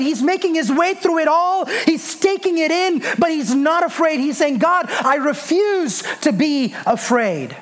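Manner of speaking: high, driving sermon delivery; impassioned preaching. Real, not acted. Preacher urging congregation to have faith in darkness, high impassioned preaching.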